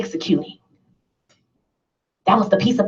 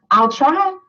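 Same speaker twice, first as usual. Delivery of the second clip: "I'll try" higher, with a lifting whiny rise on "try"; mocking the half-hearted promise; 5 semitones higher than usual. Shown as a demonstration. Speaker mocking members who only promise to try. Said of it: "That is not in our dictionary".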